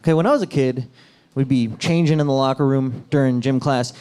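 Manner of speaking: normal talking voice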